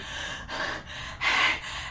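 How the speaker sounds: ragged breathless gasping in and out; hyperventilating gasps. Retold or imitated. Retelling the panic call to a friend, breathless gasping. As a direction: gasp for air like you can't stop panting. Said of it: "hyperventilating like a mad woman".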